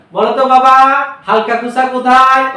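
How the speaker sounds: put-on teacher playfully quizzing a young pupil; teacher quizzing a child. Shown as a demonstration. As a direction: ask the little one cheerfully. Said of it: "আবার জিজ্ঞেস করেন".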